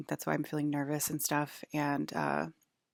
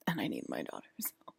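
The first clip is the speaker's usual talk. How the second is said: whispered confession; 7 semitones lower than usual. Real, not acted. Telling viewers she needs her daughter's help, in a whisper. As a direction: whisper it like a secret you're ashamed of.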